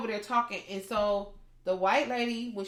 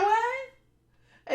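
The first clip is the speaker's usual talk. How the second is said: high, loud, stunned "what"; shocked what; 5 dB louder than usual. Retold or imitated. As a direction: gasp it out in disbelief. Teller's shocked reaction.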